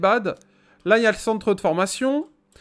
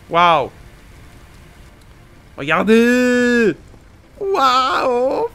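amazed; much slower than usual. Real, not acted. Amazed at graphics.

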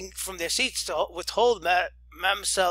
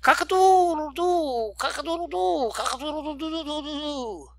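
rooster crow; 9 semitones higher than usual. Acted. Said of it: "her crow, it was delicious".

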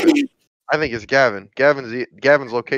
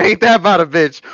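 louder and higher, hyped-up catchphrase; 6 semitones higher, 6 dB louder than usual. Shown as a demonstration. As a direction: belt the catchphrase out. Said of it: "Aye, aye".

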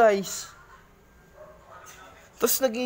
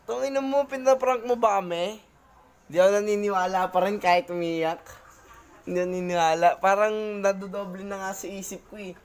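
ordinary delivery

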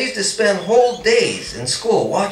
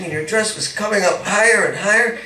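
usual speaking voice

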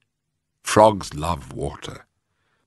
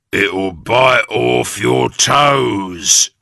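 very slow, louder sly menace; Mister Twit; 3 semitones higher, 5 dB louder, much slower than usual. A narrator's reading. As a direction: draw out the threat. Husband scaring his wife.

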